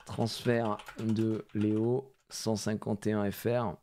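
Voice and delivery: slow, teasing build-up before the reveal; slow drawn-out reveal. Shown as a demonstration. Showing viewers a card, slowly drawn-out reveal. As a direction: stretch each word out slowly. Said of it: "ouais, préparez-vous".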